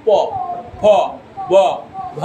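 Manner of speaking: each consonant sounded alone with pauses; letter sounds. Shown as a demonstration. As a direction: sound out প ফ ব ভ one by one. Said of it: "আবার যদি প বলি".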